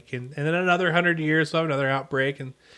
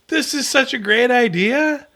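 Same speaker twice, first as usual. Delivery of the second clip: higher, slow, fake enthusiasm; drawn-out sarcastic praise, pitched up; super sarcastic; 6 semitones higher, slower than usual. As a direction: gush slowly as if you love it, not meaning it. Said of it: "I'm just super sarcastic".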